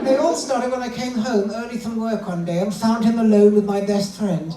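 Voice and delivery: high, aggrieved wife puppet; put-on high female character; feminine voice. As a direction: tell the grievance as the lady dummy. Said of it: "let's find a nice feminine voice".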